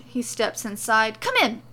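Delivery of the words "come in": high, loud call; eager, raised-voice invitation; excited urging. A narrator's reading. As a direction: urge them in excitedly. Excited host urging visitors inside.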